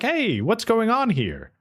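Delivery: lightly acted bystander calling out a question; curious bystanders. Bystanders roll up, puzzled.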